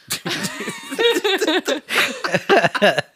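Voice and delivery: high, long burst of laughter. Real, not acted. Friends hearing the awkward greeting to dad, high laughter. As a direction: crack up at the punchline.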